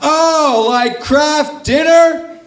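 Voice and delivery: high, slow mock amazement at the name; mock amazed people. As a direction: ham up the amazement, drawing out Kraft Dinner. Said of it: "They're like".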